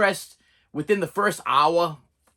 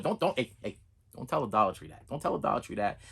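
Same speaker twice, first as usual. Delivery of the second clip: low, hushed mock secret; confidential aside; 7 semitones lower, 8 dB quieter than usual. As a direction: drop your voice as if the store could hear.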